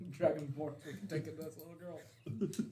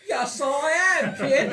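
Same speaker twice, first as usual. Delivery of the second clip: slow, drawn-out, laughing condescension; smug reply; 4 semitones higher, 8 dB louder, slower than usual. Acted. Player mocks the hero's reply to the child, smug and slow. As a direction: talk down to the kid slowly. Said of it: "what a fucking asshole".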